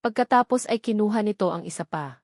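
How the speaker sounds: ordinary delivery, nothing marked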